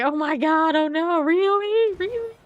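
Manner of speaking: high, loud gasping disbelief; mock shock. Teammates mocked as shocked, loud and high. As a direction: ham up their shocked disbelief. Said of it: "They're like, full of the".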